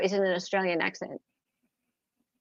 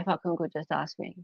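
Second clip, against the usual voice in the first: much softer, lower repeat of the phrase; muttered quote; 5 semitones lower, 9 dB quieter than usual. Shown as a demonstration. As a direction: murmur it to yourself, amused. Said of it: "Yeah, yeah".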